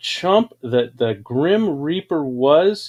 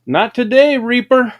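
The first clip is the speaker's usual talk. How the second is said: louder and higher, defiant refusal; defiant to Death; 8 semitones higher, 7 dB louder than usual. Retold or imitated. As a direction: tell the Reaper off to his face. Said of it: "and said".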